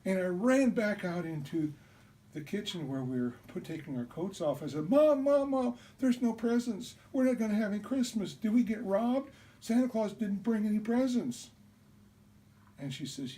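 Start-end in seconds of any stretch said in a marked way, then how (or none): 4.9-11.5 put-on very high, loud, fast alarmed child; panicked calling. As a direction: cry out to mom in sudden panic. Child panicking about no presents.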